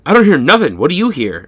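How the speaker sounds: lightly played son asking; son character. Son asking his father, mildly worked up. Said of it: "and Willie Wang says".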